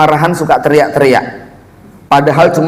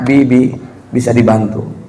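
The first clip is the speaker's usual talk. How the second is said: low, soft wifely request; quiet, lowered coaxing voice; gentle request; 6 semitones lower, 4 dB quieter than usual. Acted. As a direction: ask softly, close beside your husband. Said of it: "daripada jenengan teriak-teriak".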